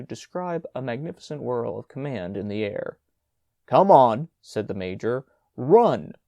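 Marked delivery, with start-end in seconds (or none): 3.7-4.3 loud urgent call. A narrator's reading. Major urging brother to run. 5.6-6.1 loud sharp command; urgent shout. A narrator's reading. Major urging brother to run.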